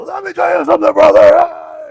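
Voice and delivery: eighties wrestling promo yell. Retold or imitated. Imitating an eighties promo, all-out shouting brother. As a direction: bellow it like an old-school wrestling promo. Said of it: "a lot of yelling and screaming".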